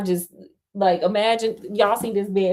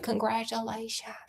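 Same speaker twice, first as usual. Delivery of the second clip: soft, whispered congratulations; hushed, barely voiced side comment; whispered aside; 3 semitones higher, 10 dB quieter than usual. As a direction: drop to a near-whisper for the aside. Hushed aside after the wedding line.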